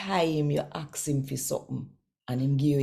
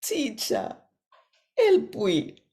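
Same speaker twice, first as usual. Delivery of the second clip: called out high and loud, begging; hollering plea; 8 semitones higher, 4 dB louder, slower than usual. A narrator's reading. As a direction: cry out to Jesus for help from a distance. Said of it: "holler out say".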